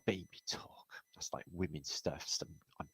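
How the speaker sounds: soft whisper, unvoiced; a whispered joke to the chat; whispered aside. Real, not acted. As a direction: breathe it as a sly aside. Aside on chat's baby talk.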